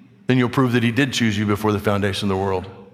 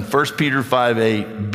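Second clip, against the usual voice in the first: ordinary delivery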